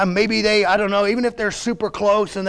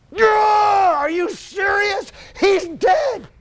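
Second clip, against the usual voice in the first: high, strained shout, drawn out; anguished, incredulous angry cry; outraged disbelief; 13 semitones higher, much slower than usual. Acted. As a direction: explode at him in grief and disbelief. Acting out Martha's angry disbelief.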